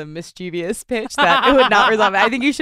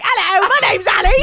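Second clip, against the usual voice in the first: put-on shouted, high, awful British accent; hammed-up British greeting, loud and high; terrible British accent; 11 semitones higher, 8 dB louder than usual. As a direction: blare it in the worst British accent possible. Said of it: "the worst accent you can possibly do".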